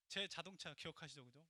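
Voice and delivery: usual speaking voice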